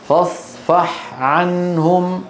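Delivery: فاصفح عنهم slow, ح distinct before ع; clearly separated Ha. Shown as a demonstration. Teacher showing students how to keep ح clear beside ع. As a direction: finish the breathy ح of فاصفح before starting عنهم. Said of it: "انتبهتوا كيف؟".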